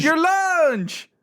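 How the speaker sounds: high Southern twang, "lunch" stretched out; Southern accent. Pretend. Co-host correcting a mishearing, in a Southern accent. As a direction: insist in a drawn-out Southern accent. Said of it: "with, with this accent".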